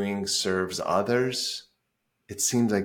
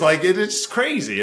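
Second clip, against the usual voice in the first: higher, louder, marveling at it; excited; 9 semitones higher, 6 dB louder than usual. Real, not acted. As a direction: let the disbelief burst out on "crazy". Repeats crazy, first more excited.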